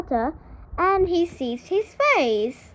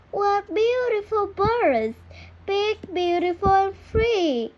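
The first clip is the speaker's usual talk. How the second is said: high, awed cry of wonder; excited duckling cry; 7 semitones higher than usual. A narrator's reading. Duckling sees swans and cries out in high wonder. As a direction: gasp at the birds, voice lifted high. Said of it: "he cries".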